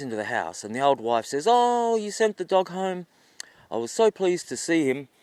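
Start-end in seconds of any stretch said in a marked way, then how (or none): 1.5-5.1 high, loud gushing welcome; gushing delight. Acted. Old wife lying to husband about the dog, gushing. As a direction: gush with a long, lifted "Oh".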